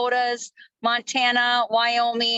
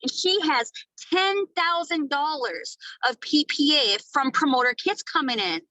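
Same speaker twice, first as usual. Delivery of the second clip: ordinary talk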